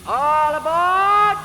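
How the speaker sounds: long, high, drawn-out call to board; cried out. A narrator's reading. Conductor calling the boy aboard, slow high cry. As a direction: sing it out slowly from the open door. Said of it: "the conductor cried out".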